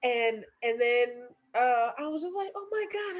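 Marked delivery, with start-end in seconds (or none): 2.5-3.2 high, soft amazement, re-enacted; awed. Mother seeing her newborn, high soft awe. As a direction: breathe it out in wonder.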